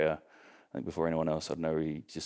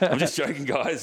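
higher pitched laughing repeat; 9 semitones higher, 6 dB louder than usual. Retold or imitated. Retelling the flat joke, laughing high. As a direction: laugh hard through the line, voice up high.

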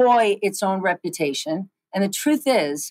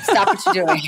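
mother scolding; 11 semitones higher, 4 dB louder than usual. Retold or imitated. Mother's punchline in retold call.

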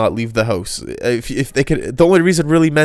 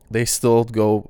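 ordinary talk, nothing marked

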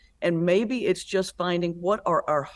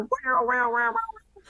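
mock squeal of a small child, much higher; imitating a toddler's squeal; 12 semitones higher, slower than usual. Toddler running down the church aisle, squealing. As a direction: squeal it like a two-year-old running. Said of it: "comes screaming down the aisle".

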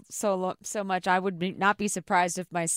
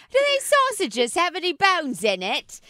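put-on Peppa Pig British accent; 8 semitones higher than usual. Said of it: "Where did he get that from?".

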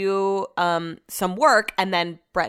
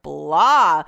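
drawn-out, louder blah; fed-up, stretched exclamation; 6 dB louder than usual. Real, not acted. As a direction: let the word flop out long. Voices low mood as drawn-out blah.

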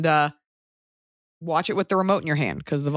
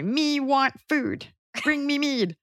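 slow, high caveman grunt; barbarian; 8 semitones higher, slower than usual. Pretend. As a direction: dumb brute demanding food. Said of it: "And you say".

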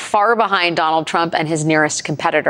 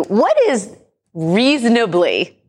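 reasonably drawn out slowly with weight; stress on reasonably; 3 semitones higher, slower than usual. Shown as a demonstration. Host asking Steve about Christie's best case, stressing reasonably. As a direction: lean hard on reasonably, slowing right down. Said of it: "emphasis on the word reasonably".

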